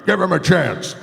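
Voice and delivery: lightly voiced old announcer; old radio guy. Old announcers vouching for the kid, lightly voiced. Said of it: "old guy sitting around".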